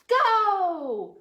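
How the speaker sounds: loud high cheer; shout. Acted. Reader shouting go to start the demolition. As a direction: launch the swing with a yell.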